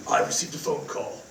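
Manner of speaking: low hushed brooding killer voice; assassin's voice. Acted. [name] tells roommate Cody about the call in his assassin voice, low and hushed. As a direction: mutter it like a grim movie assassin. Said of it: "it's my assassin's voice".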